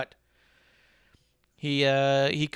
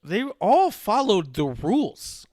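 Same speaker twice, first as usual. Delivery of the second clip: high, slow, loud mock protest; exasperated retort; 8 semitones higher, 7 dB louder, much slower than usual. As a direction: plead the case, hammed up and drawn out. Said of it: "And I was like".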